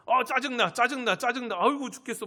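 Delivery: mock exasperation. Acts out a grumbling patient.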